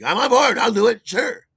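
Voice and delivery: high, loud and eager; eager yes. Retold or imitated. Agreeing to run lights for Virgil's show, eager and loud. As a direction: jump at the offer.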